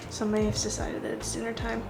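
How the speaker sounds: normal talking voice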